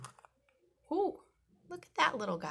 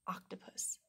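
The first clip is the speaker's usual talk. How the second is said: whispering; 8 dB quieter than usual. Acted. Whispered word to the children.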